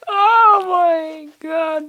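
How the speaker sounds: drawn-out, very high "oh my God"; exasperated squeal. Real, not acted. Speaker frustrated at his own brain fart, high and slow.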